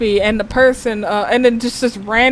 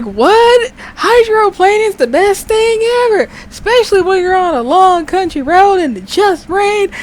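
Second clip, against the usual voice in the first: much higher and louder, thrilled; excited country Texan; 9 semitones higher, 3 dB louder than usual. Retold or imitated. Country Texan raving about hydroplaning to her, high and loud. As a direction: gush like a reckless country boy. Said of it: "it was like".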